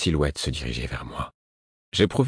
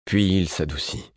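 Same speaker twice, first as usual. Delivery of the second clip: ordinary delivery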